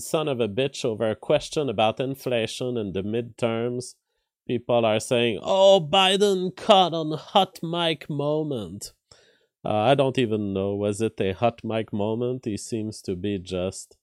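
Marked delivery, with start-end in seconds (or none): 5.4-8.9 high, slow mocking quote of online crowd. Streamer mocking the online crowd, high and slow. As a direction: sneer it like gleeful commenters.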